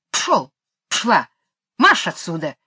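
гонит пса, громче обычного; сгоняет собаку окриком; лёгкий крик. A narrator's reading. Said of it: "закричала она". (мисс Ларк гонит пса, лёгкий окрик)

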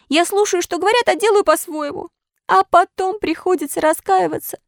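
high, tearful puppet lament; weeping lament. A narrator's reading. Pinocchio crying over injured Eugene, high tearful lament. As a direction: wail over your friend in despair. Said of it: "Он плакал над ним".

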